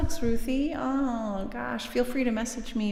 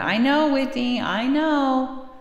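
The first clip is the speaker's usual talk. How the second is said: usual speaking voice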